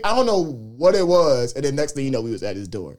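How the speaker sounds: ordinary delivery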